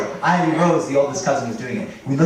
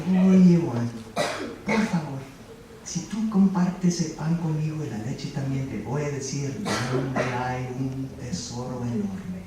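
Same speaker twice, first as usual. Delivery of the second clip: a hint of a quiet old man, softer; lightly voiced old man, hushed and secretive; 4 semitones lower, 6 dB quieter than usual. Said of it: "And the old man said".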